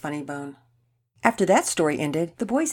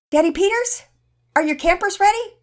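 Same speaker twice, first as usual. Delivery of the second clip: high, loud call across the house; yelling out; 13 semitones higher, 9 dB louder than usual. A narrator's reading. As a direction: holler for your husband, high and loud. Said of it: "Mama Peters yelled out".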